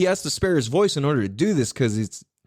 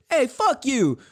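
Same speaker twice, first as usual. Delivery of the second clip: high-pitched bantering retort; mock comeback; 11 semitones higher, slower than usual. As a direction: toss back a playful insult, pitched up. Acts out the expected banter comeback.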